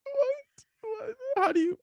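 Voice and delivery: squeaky high stunned what; disbelieving, high-pitched shock; shocked disbelief. Real, not acted. Stunned listener reacts.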